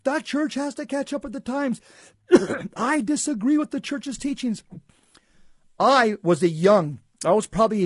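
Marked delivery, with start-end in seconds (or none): 0.0-4.6 high-pitched mock shout, slowed and drawn out; hammed-up priest raging against the church; retold screaming tirade. Dying priest rails against church teaching, mock shout. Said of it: "He began screaming at the top of his lungs".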